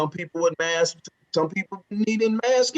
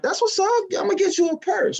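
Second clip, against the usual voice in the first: much higher, fast eager promise; mock eager; 11 semitones higher, faster than usual. Boyfriend promising girlfriend a purse, high and eager. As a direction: play the eager boyfriend, pitched way up. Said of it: "And I'm like".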